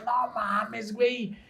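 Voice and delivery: high, laughing Mexican slang voice; Mexican accent. Retold or imitated. Siblings mock his Salvadoran speech in Mexican accent, laughing. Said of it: "mis hermanos de México me decían".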